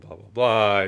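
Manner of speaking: usual speaking voice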